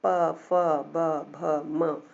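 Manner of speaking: very slowly pronounce प, फ, ब, भ, म, each lips-closed and distinct; drawn-out model of प, फ, ब, भ, म for the class; slow lip consonants. Shown as a demonstration. Teacher to pupils, modelling lip sounds: very slow and deliberate. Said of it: "इनको ये कहा जाता है".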